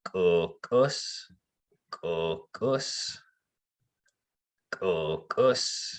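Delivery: "kokos" with both Ks glottal-caught; double glottal K. Shown as a demonstration. Teacher saying the word slowly with two glottal Ks. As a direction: catch each K of "kokos". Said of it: "two glottal Ks in this word".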